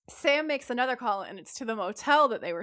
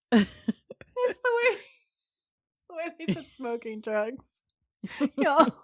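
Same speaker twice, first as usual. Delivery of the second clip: barely holding it together; laughing; 3 semitones higher, slower than usual. Real, not acted. Cracking up telling it.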